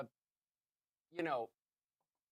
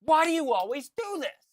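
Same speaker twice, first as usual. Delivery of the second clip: exasperated buddy; 8 semitones higher, 6 dB louder than usual. Retold or imitated. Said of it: "his buddy's like".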